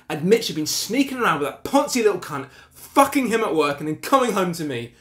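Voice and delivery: loud, high, furious tirade; screamed accusation. Acted. Husband demanding his wife admit the affair, enraged. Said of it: "I screamed at her to admit it".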